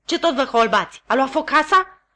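loud, high scolding; snapping. Acted. Sister snapping at staring Pip and Joe, loud. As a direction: scold them sharply. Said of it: "se răsti sora mea".

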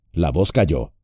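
ordinary delivery, nothing marked

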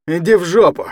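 usual speaking voice

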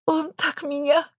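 slow, high, sob-broken words; dissolving into sobs. Acted. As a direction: let sobs swallow it. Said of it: "потонуло в рыданиях".

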